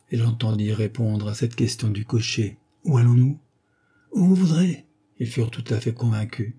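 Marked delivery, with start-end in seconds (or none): 2.8-3.4 louder brusque figure voice; lightly voiced coachman calling back; coachman's question. A narrator's reading. Coachman asks his fleeing passenger the destination, calling out. 4.1-4.9 loud, high-pitched outburst; desperate to get away; frantic reply. A narrator's reading. Fugitive answers the coachman's question, frantic.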